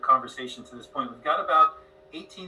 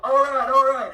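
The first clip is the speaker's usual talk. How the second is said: fans' catchphrase; 10 semitones higher, 9 dB louder than usual. Retold or imitated. Host quoting friends' catchphrase, loud and high. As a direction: shout it like fans quoting him. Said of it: "they're all".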